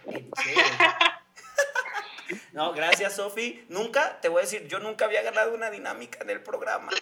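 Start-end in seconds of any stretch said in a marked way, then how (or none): 0.6-1.1 high, loud burst of laughter. Real, not acted. Host laughing at the teasing, high laugh.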